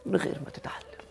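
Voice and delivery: hushed, whispered recitation. Retold or imitated. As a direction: drop suddenly into a whisper. Echoes the lyric in a whisper. (récité en chuchotant)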